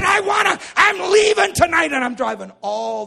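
shouted announcement, easing toward the end; excited resolve. Acted. Brother deciding to drive to church, excited shout easing off. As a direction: start high and loud, then come down.

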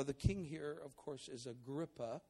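usual speaking voice

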